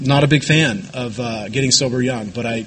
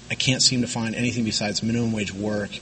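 ordinary talk

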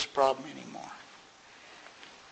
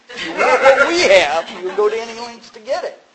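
loud quoted creed; 7 semitones higher, 12 dB louder than usual. Retold or imitated. AA old-timers telling newcomer, loud and laughing. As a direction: proclaim the motto half laughing.

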